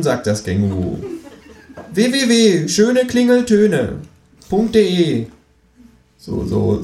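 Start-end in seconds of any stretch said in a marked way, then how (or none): 1.9-5.3 high, slow jingle-like spelling; advert announcer. A narrator's reading. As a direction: sell it like an ad. Kangaroo announces web address.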